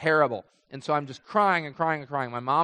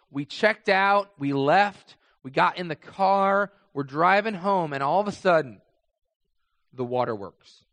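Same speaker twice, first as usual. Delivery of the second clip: ordinary delivery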